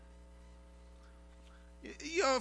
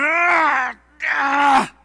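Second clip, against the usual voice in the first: pained shouted groan, much higher and louder; 10 semitones higher, 14 dB louder than usual. Shown as a demonstration. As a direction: groan like your gut is twisting. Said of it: "I'm gonna try to do it good".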